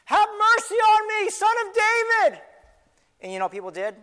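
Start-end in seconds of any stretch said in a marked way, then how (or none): 0.1-2.4 full-voiced cry for mercy, high and loud; desperate high shout; yelling out. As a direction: yell over a crowd to be heard. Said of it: "so I started yelling".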